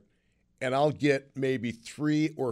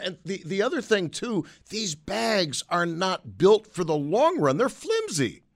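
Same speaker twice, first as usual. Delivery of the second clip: much higher, animated and fired up; excited; 6 semitones higher, 4 dB louder than usual. Real, not acted. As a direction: get worked up agreeing. Host fired up agreeing with caller.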